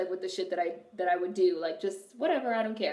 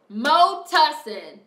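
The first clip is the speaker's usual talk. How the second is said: "Mo tussin" slow, loud and higher; meme shout; 6 semitones higher, 8 dB louder, much slower than usual. Pretend. Meme quote about cough syrup.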